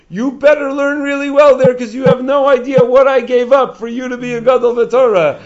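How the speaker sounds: high, loud mother's warning; stern warning. Retold or imitated. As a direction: imitate a mother insistently warning her son. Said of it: "she kept on warning me".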